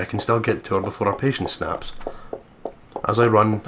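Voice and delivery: usual speaking voice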